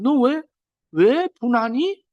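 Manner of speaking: drawn-out, gentle asking; slow gentle question. Acted. God slowly asks Cain why he is angry, gently. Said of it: "이렇게 물어봤어요".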